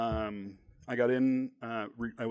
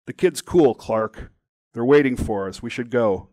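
louder, casual call to a friend; raised-voice urging, lightly acted; calling over; 2 semitones higher, 11 dB louder than usual. A narrator's reading. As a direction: call out that it's time to go.

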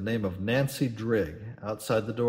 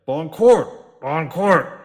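much louder, furious bellow; captain roaring at the staring neighbours; angry roar; 5 semitones higher, 11 dB louder, much slower than usual. Acted. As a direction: roar it at the onlookers. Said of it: "he roared angrily".

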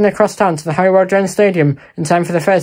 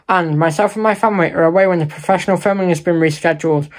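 normal talking voice

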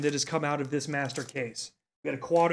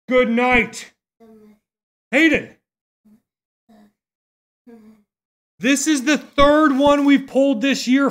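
excited; 5 semitones higher, 8 dB louder than usual. Real, not acted. Big diamond card pulled live.

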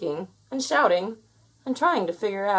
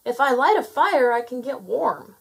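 raised, higher thinking aloud; thought loudly; 5 semitones higher, 5 dB louder than usual. A narrator's reading. Gnome planning a fire, thinking loudly. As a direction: think it out loud, a little forcefully. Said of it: "he thought loudly".

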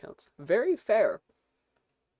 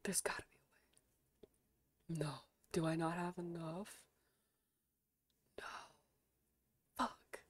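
desperate whisper; 9 dB quieter than usual. Real, not acted. Streamer checking her bank balance, whispering. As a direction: whisper to yourself, hoping.

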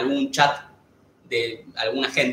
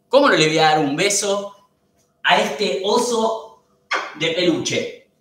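cooing; 4 semitones higher, 3 dB louder, slower than usual. Real, not acted. Owner cooing at her dog on camera. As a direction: coo over the dog like a teddy bear.

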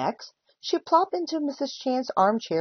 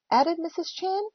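ordinary delivery

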